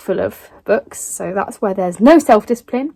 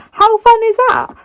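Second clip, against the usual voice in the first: high, louder gushing exclamation; excited squeal; 11 semitones higher, 4 dB louder than usual. Real, not acted. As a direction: squeal it out with pure delight. Gushing about signed arc.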